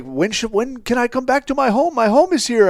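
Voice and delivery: high-pitched, distressed questioning; imitated anxious plea. Banished man asking his handler about returning, anxious plea. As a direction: mimic a man begging to come home.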